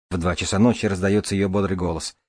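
ordinary delivery, nothing marked

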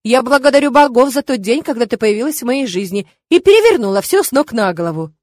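exasperated, effusive reassurance; sighing protest. A narrator's reading. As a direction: protest with exaggerated gratitude. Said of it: "вздохнул папа".

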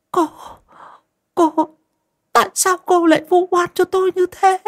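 put-on high, hurt old woman's voice; indignant old woman. Grandmother confronting her daughter-in-law about the slander, high hurt protest. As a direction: stammer then protest the false accusation.

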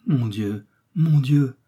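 restrained cry, rising on the repeat; dying cry. A narrator's reading. Dying apostle crying out to God, pleading. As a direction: call to God, stronger the second time. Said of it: "s'écria l'apôtre mourant".

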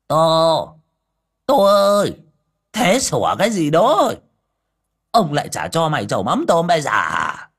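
loud, high bark at the dog; scolding shout. A narrator's reading. Mr. Đồng comes out and shouts at his barking dog. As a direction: yell the dog into silence. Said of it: "đi ra ngoài mà quát".

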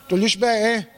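ordinary talk

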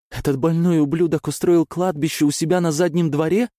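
high, loud horrified yell; horrified shout. Acted. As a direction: scream it in horror. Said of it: "закричала она".

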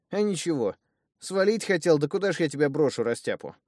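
high and loud, rushing through; heated retelling. Acted. Thug tells his brother he stayed, high and excited. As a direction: blurt it out, worked up.

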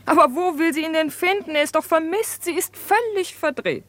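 high-pitched, exasperated fretting. Acted. Exasperated about her friend's search.

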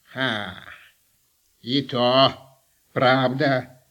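low, slow, creaky crone; old witch voice. Acted. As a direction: drawl it out like Baba Yaga. Baba Yaga agrees.

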